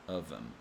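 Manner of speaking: of them softly as "ovem"; reduced of them, no th; dropped th. Shown as a demonstration. Teacher repeating reduced of them. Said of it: "pronounce it as of them".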